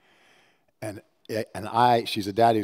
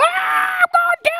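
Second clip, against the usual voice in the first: very high loud excited squeal; squealing insistence; 11 dB louder than usual. Retold or imitated. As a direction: squeal it like an eager child. Moses voiced like the daughter.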